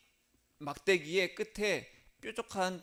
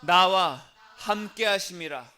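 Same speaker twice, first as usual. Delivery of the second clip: loud, slow model phrase for repeating; call to repeat; 7 dB louder, slower than usual. Shown as a demonstration. Preacher to congregation to repeat after, loud measured call. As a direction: lead the room in saying it. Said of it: "따라하겠습니다".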